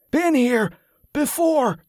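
high, strained burst of words; delirious outburst. A narrator's reading. Feverish man blurting at the sight of the abbey, high outburst. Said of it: "the words burst out past foam-flecked lips".

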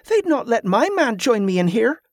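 high-pitched, aggrieved protest; indignant exclamation. A narrator's reading. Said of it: "exclaimed a woman".

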